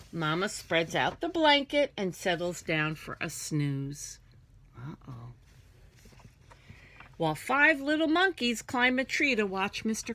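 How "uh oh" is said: hushed suspense; whispered uh-oh. Acted. Teacher whispering to the class as danger nears. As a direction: breathe it out like a secret.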